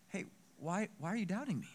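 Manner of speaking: soft, hushed, puzzled appeal; gentle questioning. Acted. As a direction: ask it quietly, without blame. Jesus gently asks the doubter.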